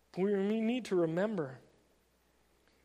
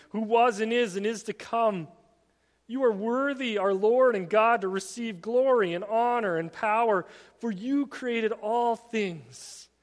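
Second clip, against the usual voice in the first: scripture declamation; 2 semitones higher, 8 dB louder than usual. Acted. Preacher reading Revelation's praise to the congregation, loud declamation. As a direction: proclaim it as full-voiced worship.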